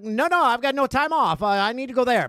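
loud, high mock-urgent protest; insistent refusal. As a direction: act out a flustered, insistent excuse. Acting pen pal's urgent refusal.

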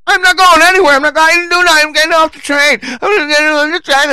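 very high, loud hysterical whine; shrieky mocking protest, far above normal pitch; mock screeching. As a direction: mimic a grown man throwing a squealing tantrum. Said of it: "screeching at the top of his lungs".

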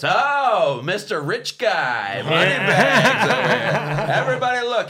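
loud, high, slow mocking jeer; mocking Dave Waite. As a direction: jeer at the tourists for everyone to hear. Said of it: "And Dave Waite goes".

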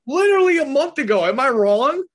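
high, loud, exasperated outburst; heated. Real, not acted. Bears fan challenging co-host to agree, heated. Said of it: "Why are you so heated?".

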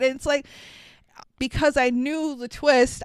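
usual speaking voice

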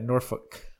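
Norfolk said quickly as "NOR-fuk"; said real quick. Shown as a demonstration. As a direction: swallow the second syllable of Norfolk, "NOR-fik". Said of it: "say it real quick like that".

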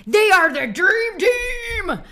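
high, loud, slow hype; excited. Pretend. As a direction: belt it out like a cheerleader. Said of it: "American accent".

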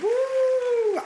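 high excited whoop; cheering whoop. Real, not acted. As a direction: let out a joyful whoop. Cheering herself.

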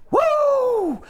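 huge high wrestling "Woo!"; Ric Flair woo. Retold or imitated. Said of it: "and I went".

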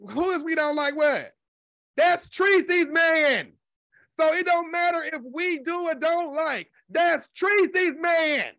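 high, drawn-out exclamation; indignant insistence. Real, not acted. Commentator rebutting his meddling with Tracy's man, high exasperated insistence.

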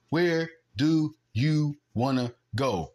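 slow, word-by-word taunt; drawn-out challenge. Real, not acted. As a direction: space out every word like a dare. Spaced-out challenge to imagined doubters.

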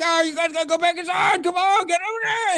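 high, fast frantic yelling at hostages; mock frantic McClane. Action hero yelling at hostages to get back inside, high frantic shout. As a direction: shoo them back inside like a panicked cop. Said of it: "John McClane's like".

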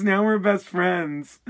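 ordinary delivery

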